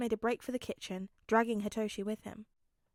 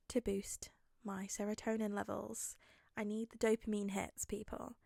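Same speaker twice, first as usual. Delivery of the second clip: whisper; 5 dB quieter than usual. Real, not acted. Playful whispered aside.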